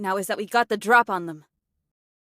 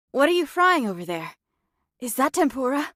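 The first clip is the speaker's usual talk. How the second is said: ordinary delivery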